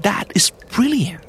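awed whisper, no voice. Shown as a demonstration. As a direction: breathe it out in wonder. Whispered repeat of phrase.

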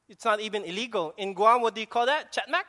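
usual speaking voice

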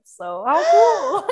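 slow, high, loud delighted squeal; thrilled. Real, not acted. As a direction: savour the good news out loud. Announcing her first sponsorship.

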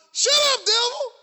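high, loud rebuke. Acted. Preacher rebuking the devil at home, loud and fierce. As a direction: shout the devil down.

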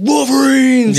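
high rallying shout; battle cry. Retold or imitated. Quoting Red Dawn battle cry.

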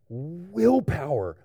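slow, drawn-out word of wonder; lightly acted awestruck kid; mock revelation. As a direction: stretch it out like a child's big discovery. Recalls kindergarten self discovering willpower.

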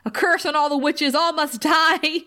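high, melodramatic imitated curse; hammed-up vow of doom, slowing at the end; mock troll curse. Retelling the troll cursing witches.

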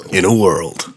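dramatic trailer narrator; movie trailer voice. Pretend. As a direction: announce it like an epic movie. Mock movie trailer intro.

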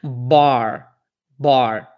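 bar with an American R at the end; English R demo. Shown as a demonstration. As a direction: say bar, curling the final R.

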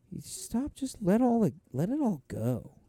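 high, hushed feminine guide voice; meditation woman. Retold or imitated. Host imitating the woman on the meditation app, soothing. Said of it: "just a chick usually who's just, like, telling you".

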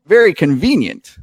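high twangy driver greets the heroes; folksy NPC. Acted. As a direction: sound pleased at the timing. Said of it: "And he says".

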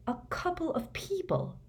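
hoarse, high and soft disbelief. A narrator's reading. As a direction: croak it out in shock. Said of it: "said Harry hoarsely".

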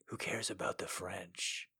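low, hushed, soft; low quiet voice. Pretend.

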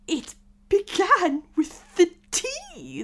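high, loud, slow wail; flustered Hatter. Acted. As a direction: wail the explanation slowly, high and anxious. Nervous Hatter answers King.

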